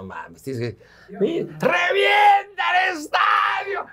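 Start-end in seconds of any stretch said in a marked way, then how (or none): 1.6-3.8 high, slow, shouted goal call; mock stadium roar, dragged out; excited shout. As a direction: yell it like a commentator losing it.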